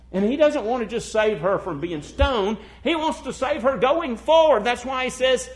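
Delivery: loud, high, fast revival preaching; preaching at full force. Acted. Preacher to congregation about Christ's return, loud fervent preaching. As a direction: thunder it out to the congregation. Preacher rises again in fervour.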